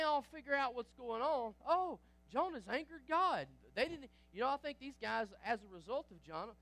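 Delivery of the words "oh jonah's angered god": realizing. Retold or imitated. Sailors realizing the cause.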